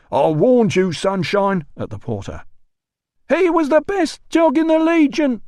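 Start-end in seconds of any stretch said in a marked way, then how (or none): 0.1-1.6 loud, high furious roar. Acted. 3.3-5.4 put-on high, loud drunken yell. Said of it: "yelled the porter".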